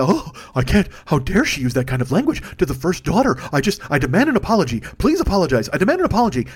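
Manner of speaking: scandalised, gasping outrage; hammed-up offended prissiness; mock pearl-clutching. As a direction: demand it like a shocked prude. Mocks pearl-clutching outrage at bad language.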